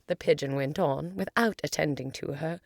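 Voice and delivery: usual speaking voice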